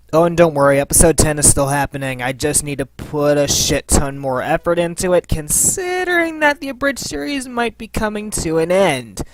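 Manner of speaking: ordinary talk, nothing marked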